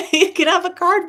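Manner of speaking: high, loud and laughing through it; giggling at her own clumsy shuffle. Real, not acted. Card mishap while shuffling.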